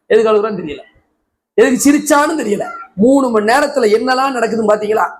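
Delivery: ordinary talk, nothing marked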